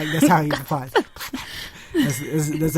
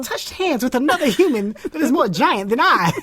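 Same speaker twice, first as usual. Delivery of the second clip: put-on high, grandly amazed child voice; a kid making a big ceremony of it; mock solemn child marvelling at a high five; awed kid; 7 semitones higher than usual. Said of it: "they're like".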